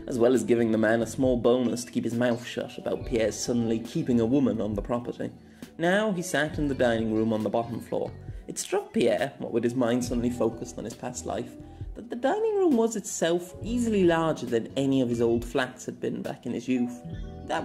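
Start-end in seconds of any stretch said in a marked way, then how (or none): none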